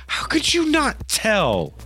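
put-on boy rebuking Penny, high and hushed; grown-up kid reproach. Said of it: "Is that how he talks?".

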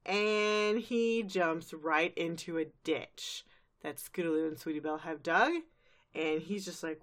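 usual speaking voice

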